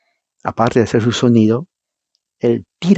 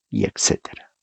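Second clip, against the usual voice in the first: dropped to a whisper; 6 semitones lower, 8 dB quieter than usual. Real, not acted. Trailing list of nations.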